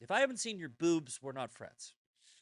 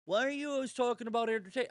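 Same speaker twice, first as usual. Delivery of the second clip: mock whiny critic; 8 semitones higher, 4 dB louder, faster than usual. Mimicking critics' whiny questions.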